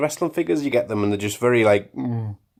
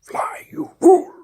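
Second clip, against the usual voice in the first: high, slow, strained whispered cry of warning; Gandalf impression; 6 dB louder, slower than usual. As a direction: hiss it out like the old wizard on the bridge.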